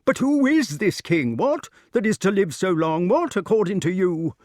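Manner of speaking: high, loud querulous challenge; petulant. A narrator's reading. Pellinore questions Grummore about the king, petulantly. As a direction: demand it peevishly, pitched high. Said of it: "exclaimed King Pellinore petulantly".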